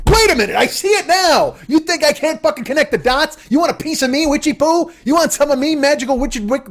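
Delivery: high, worked-up challenge; mock outrage. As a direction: pick a hammed-up fight with a witch. Mock challenge to an imagined witch.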